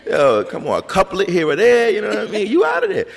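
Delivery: hyping up the easy way out; excited hype. Real, not acted. Riffing with the host about quick haikus, loud excited joking.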